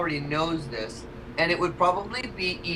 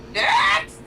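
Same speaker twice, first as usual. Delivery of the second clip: calling out; 6 semitones higher, 6 dB louder than usual. Acted. Calling the next question.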